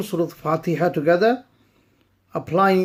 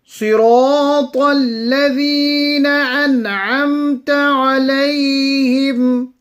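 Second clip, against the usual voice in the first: melodic tajweed recitation, a touch higher; Quran recitation; 5 semitones higher, 4 dB louder than usual. Acted. Teacher reciting the verses for students, melodic and measured. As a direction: chant the verses slowly and evenly.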